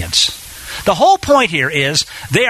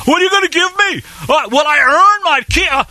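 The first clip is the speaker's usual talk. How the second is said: mock haggling Abram; 11 semitones higher than usual. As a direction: play a grasping man haggling with God, pitched up. Preacher voices a bargaining Abram.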